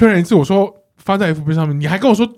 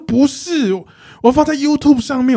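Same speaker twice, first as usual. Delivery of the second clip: mock angry coworker; 8 semitones higher than usual. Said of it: "然后她就很生气".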